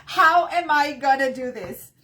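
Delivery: exasperated laugh at herself; laughing exasperation. Retold or imitated. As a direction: laugh off the overwhelm. Retold overwhelmed moment, laughs.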